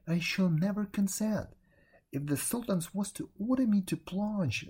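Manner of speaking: a hint of a pleading old vizier; anguished father. A narrator's reading.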